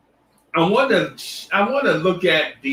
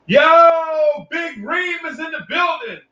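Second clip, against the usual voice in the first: much higher, yelled hype call; excited shout-out; 12 semitones higher, slower than usual. Real, not acted. Streamer greeting a viewer in chat, high excited yell.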